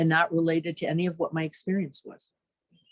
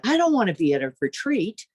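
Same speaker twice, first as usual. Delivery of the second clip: much higher, louder mock complaint; exasperated inner voice; 10 semitones higher, 3 dB louder than usual. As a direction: whine like a fed-up retreatant. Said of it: "He's like".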